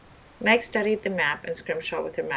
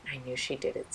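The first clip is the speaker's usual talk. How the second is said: softer, low sneer; hushed; 4 semitones lower, 6 dB quieter than usual. Acted. Anthony muttering about Doctor Peck, soft and low. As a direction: lean in and say it quietly. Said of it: "said Anthony".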